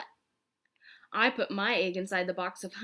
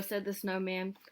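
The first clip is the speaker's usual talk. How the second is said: usual speaking voice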